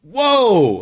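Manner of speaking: retold stunned whoa; loud high mock amazement; amazed whoa. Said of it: "And I was like".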